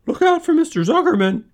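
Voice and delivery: very high, loud squawk; piercing bird-voiced yell; gander yelling. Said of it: "yelled the gander".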